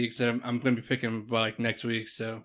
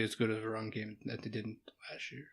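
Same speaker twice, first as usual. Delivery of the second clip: hushed, voice dropping softer as it goes; near whisper; 4 semitones lower, 13 dB quieter than usual. Real, not acted. Guest hiding a cracking voice from fans, nearly whispering. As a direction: back off to save a cracking voice. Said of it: "Why did you start whispering?".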